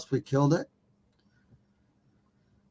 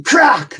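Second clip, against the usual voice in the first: a sharp, high, loud imitated snap; loud crack; 12 semitones higher, 8 dB louder than usual. Retelling the night a branch snapped, loud sharp crack. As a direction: spit out the crack like a branch breaking.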